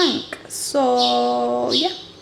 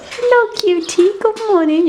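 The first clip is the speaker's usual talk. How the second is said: cooing at a kitten; 11 semitones higher, 5 dB louder than usual. Real, not acted. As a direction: coo up high to the cute kitten. Greeting a kitten.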